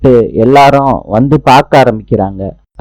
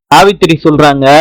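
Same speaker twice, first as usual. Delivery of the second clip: ordinary delivery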